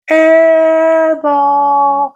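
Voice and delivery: high and loud gloat; high, loud crowing over the miss. Retold or imitated. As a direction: crow it high.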